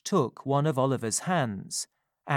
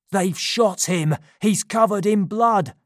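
high, loud cry of alarm; urgent, raised-voice outburst; alarmed exclamation; 6 semitones higher, 7 dB louder than usual. A narrator's reading. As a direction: shout the bad news in panic. Sikes sees Oliver has been shot.

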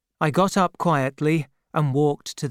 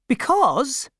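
put-on elderly aunt; 10 semitones higher than usual. A narrator's reading. Aunt's voice, held.

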